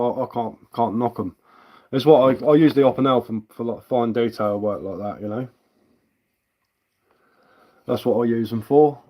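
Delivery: ordinary delivery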